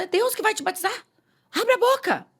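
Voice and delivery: high-pitched, insistent preacher's order; retold emphatic command. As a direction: imitate a fervent believer commanding. Reencena ordem do crente pentecostal. (imitando ordem enfática, bem aguda)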